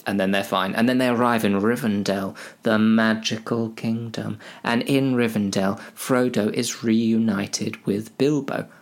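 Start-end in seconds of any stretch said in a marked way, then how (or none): none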